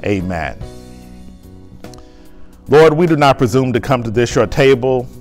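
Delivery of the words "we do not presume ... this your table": normal talking voice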